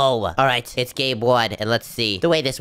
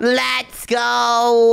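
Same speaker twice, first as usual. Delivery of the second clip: victory cheer; 10 semitones higher, 5 dB louder, much slower than usual. Real, not acted. Dragon killed, victory cheer.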